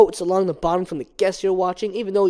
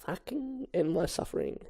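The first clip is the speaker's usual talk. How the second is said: unvoiced whisper of despair; whispered aside; 11 dB quieter than usual. Real, not acted. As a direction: breathe it to yourself. Aside while reading.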